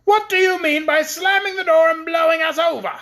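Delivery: high, loud tiny-soldier voice, offended; indignant paper soldier. A narrator's reading. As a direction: squeak out the complaint.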